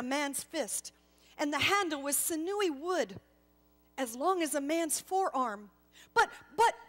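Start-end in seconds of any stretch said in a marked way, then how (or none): 6.1-6.8 much higher, louder stuttering alarm; stammering shock. Acted. Gasper seeing the hammer in his hands, shocked stammer. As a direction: sputter in disbelief.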